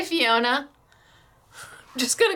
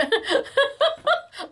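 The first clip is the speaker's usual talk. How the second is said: high excited giggle; giggly squeal; 13 semitones higher than usual. Real, not acted. Crafter fangirling over samples, giggling high. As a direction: let out a delighted high giggle. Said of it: "I'm fangirling right now".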